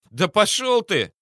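high, loud, slow hysterical cry. A narrator's reading. Cornered captain lashing out, hysterical and loud. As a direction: voice cracking into hysteria. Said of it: "выдал истеричные нотки".

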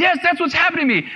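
high, rushed imitation of tearful agreement; mock crying. Young person admitting the thoughts to the minister, mock crying. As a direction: blurt it high and fast, as if crying. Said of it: "they'll start crying".

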